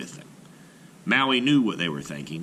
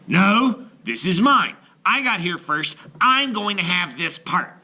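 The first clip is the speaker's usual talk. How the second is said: much higher, loud greedy shout; quarrelling shout; 10 semitones higher, 5 dB louder than usual. A narrator's reading. Eldest brother claims the island, angry shout. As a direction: claim the land angrily.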